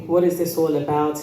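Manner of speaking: ordinary delivery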